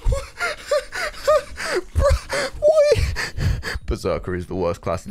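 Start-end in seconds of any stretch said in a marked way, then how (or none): 0.3-1.9 high shrieking burst of laughter. Real, not acted. Disbelief at his own damage. 2.0-3.8 much higher, louder cry of disbelief; stunned shout. Real, not acted. Disbelief at his own damage.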